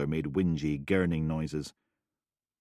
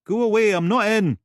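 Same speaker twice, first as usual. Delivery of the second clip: loud high shout; shouted through door; 9 dB louder than usual. Acted. Woman shouting through the door at police. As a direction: yell it through the wood.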